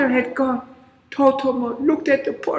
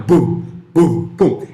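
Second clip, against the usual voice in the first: booming footsteps; 10 semitones lower, 5 dB louder, slower than usual. Acted. Giant chasing.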